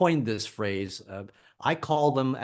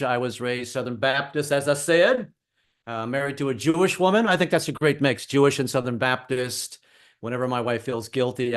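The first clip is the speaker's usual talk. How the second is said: normal talking voice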